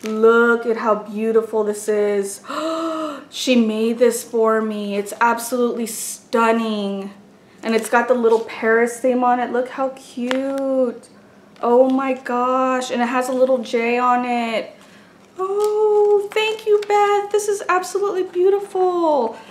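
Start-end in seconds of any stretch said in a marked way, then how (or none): 2.5-3.3 soft gasp of wonder; a quiet, high intake of breath; delighted gasp. Real, not acted. Sees a handmade notebook. 15.4-19.4 high, gushing thanks to a friend; thanking her friend for a handmade gift, high and gushing; touched delight. Real, not acted.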